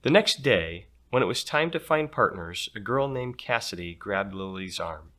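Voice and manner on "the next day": ordinary delivery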